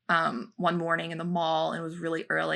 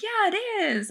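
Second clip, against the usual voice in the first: put-on high sing-song kid-friendly voice; talking to a child; much faster than usual. Answering a little child at breakfast, high kid-friendly voice.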